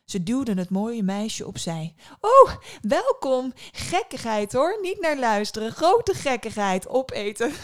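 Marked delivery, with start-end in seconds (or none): 2.2-7.5 put-on high, loud, gushing old woman; excitable old lady, high and loud; fussing old woman. A narrator's reading. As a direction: welcome loudly while brushing off nonsense. Old woman fussily welcomes the visitor.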